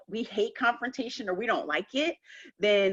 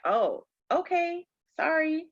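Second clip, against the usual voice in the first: higher, slow mock apology from a deflated bully; bully backing down; 8 semitones higher, slower than usual. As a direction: back down meekly, lightly hammed. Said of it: "they're like".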